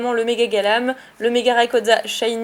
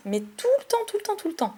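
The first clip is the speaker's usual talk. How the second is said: mock exasperation; 9 semitones higher, 4 dB quieter than usual. Real, not acted. Exasperated repetition about Entei.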